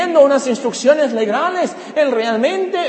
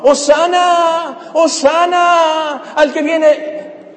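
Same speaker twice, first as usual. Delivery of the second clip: high, drawn-out acclaiming cry; crowd shouting; 7 semitones higher, much slower than usual. Acted. Preacher voices the multitude the day before, crowd shouting. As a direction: imitate a crowd hailing someone, stretching each call high. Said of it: "la gente está gritando".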